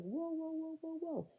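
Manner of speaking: high rapid-fire whoas; high mock-alarmed interjection; alarmed crowd imitation. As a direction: imitate onlookers stepping in. Voices the crowd stopping a fight.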